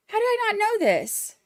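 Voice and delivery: high, stress on "I"; excited disbelief. Real, not acted. Streamer scolds herself over a missed birthday, high and excited. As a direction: ask "How did I not know this?" with the weight on "I", pitched high.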